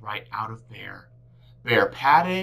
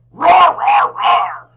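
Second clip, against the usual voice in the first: very high loud playful roar; bear roaring; 12 semitones higher, 14 dB louder, much slower than usual. A narrator's reading. Bear stomping down the mountain, roaring loudly.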